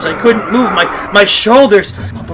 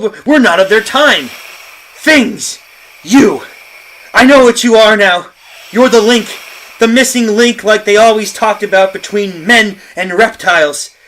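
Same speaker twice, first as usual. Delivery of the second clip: raised, forceful denunciation; defiant ranting; 12 semitones higher, 21 dB louder than usual. Acted. John facing down the reptile things, defiant ranting. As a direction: rail at the creatures, voice lifted. Man defies the creatures.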